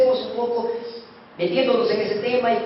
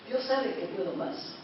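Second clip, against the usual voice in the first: quiet repeat; 11 dB quieter than usual. Shown as a demonstration. Repeats the line much softer.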